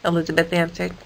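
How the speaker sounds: usual speaking voice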